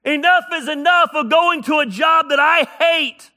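loud, high, driving preaching cadence; preaching at full force. Acted. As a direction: hammer each enough is enough from the pulpit. Sermon climax, enough is enough.

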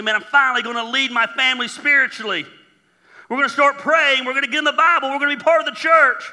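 rally the family with big eager promises; acted gung-ho determination; fired-up resolve. Acting an eager father's resolution.

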